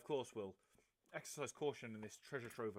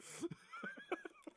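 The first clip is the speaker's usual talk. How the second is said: high laugh. Real, not acted. Player laughs after story.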